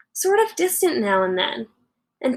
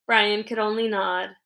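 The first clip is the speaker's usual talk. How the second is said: ordinary delivery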